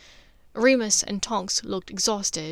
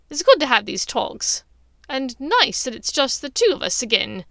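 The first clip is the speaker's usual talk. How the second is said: put-on higher and louder, a sneering witch purring over old times; Bellatrix voice; 3 semitones higher, 7 dB louder than usual. Bellatrix needles her sister, loud high sneering voice. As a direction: lay on the cruel sweetness, loud and high.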